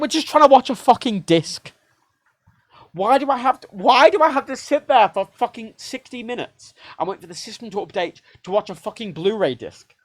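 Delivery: high, loud frustrated outburst; exasperated yell. Retold or imitated. Retelling the Blu-ray fiasco to viewers, a loud high exasperated yell. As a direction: rant it like you're fed up. Retold rage at Xbox updates.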